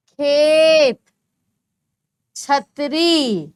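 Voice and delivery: each word drawn out slowly and loud; slow word reading. Shown as a demonstration. Teacher reading words to pupils slowly and loudly. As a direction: read each name slowly for the class. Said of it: "अब मैं नाम बोलूंगी, आप सुनेंगे".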